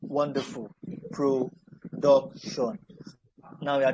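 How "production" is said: Production stretched very slowly, each syllable clear; slow model pronunciation. Shown as a demonstration. Teacher modelling the word slowly after spelling. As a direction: draw the word out. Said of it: "Can we take production together".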